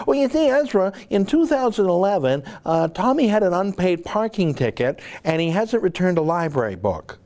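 a mocking imitation of a prim British host; mock British accent. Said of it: "I'm doing an accent".

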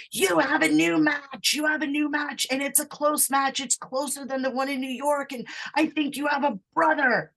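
high-pitched excited gush, imitated; excited freaking out. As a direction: act out a friend bursting with news. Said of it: "she's all excited and she's, like, freaking out".

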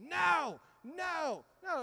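a little sister's high, loud protest, then fading; mock toddler screech of no; imitating Allie shrieking at her brother; toddler screaming no. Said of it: "she'll start screaming".

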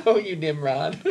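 sarcastic. Retold or imitated. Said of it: "all sarcastically".

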